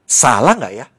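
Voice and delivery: half-mock challenge, tossed out loudly; loud testing question. Testing the voice of God, asked loudly and sharply. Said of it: "saya tes gini".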